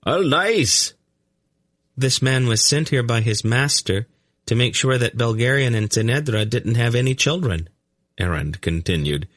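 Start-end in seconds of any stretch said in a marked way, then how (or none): 0.0-0.9 high, desperate outcry; restrained scream, very high; screaming captive. A narrator's reading. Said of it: "ignoring the now screaming captive". 2.0-7.7 put-on high, light boy's voice; high-pitched, unruffled youngster; matter-of-fact boy. A narrator's reading. Narrator voices the blond boy.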